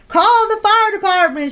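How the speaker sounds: high frantic cry; panicked plea for help. Acted. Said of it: "she cried".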